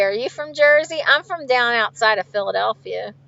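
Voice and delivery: normal talking voice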